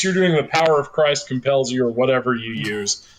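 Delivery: ordinary talk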